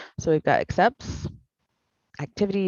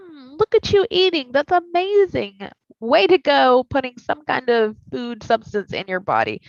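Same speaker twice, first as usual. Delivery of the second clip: high, loud cheerleading praise with a sob-like catch; gushing praise; 7 semitones higher, 4 dB louder than usual. Shown as a demonstration. Therapist modeling a kind inner voice, gushing high praise. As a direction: gush over yourself like a cheerleader. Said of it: "How about we just say".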